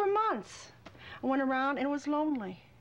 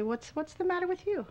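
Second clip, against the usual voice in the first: sneering question, pitched up, looking down on her; contemptuous; 7 semitones higher than usual. Retold or imitated. Bully scoffing at the injured woman, mock contempt. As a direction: scoff at her like she's beneath you. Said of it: "rather contemptuously".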